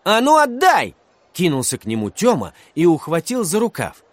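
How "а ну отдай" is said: put-on high, loud, indignant boy; loud, high-pitched angry schoolboy demand. A narrator's reading. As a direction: lunge in with a sharp demand. Boy lunges to grab his pen.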